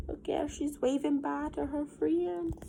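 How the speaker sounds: soft whispered aside. Real, not acted. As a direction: whisper it to the class. Pointing out picture to class.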